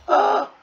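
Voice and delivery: very high, loud yelp; sudden high shriek; startled scream. Real, not acted. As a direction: scream out in shock. Screams at a game mishap.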